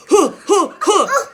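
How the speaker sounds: high, loud huffing breaths; out of breath from sprinting off; panting run. Retold or imitated. Running away from the car.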